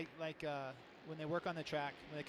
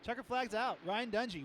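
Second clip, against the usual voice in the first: live play-by-play; 4 semitones higher, 5 dB louder than usual. Real, not acted. Reads final results on air.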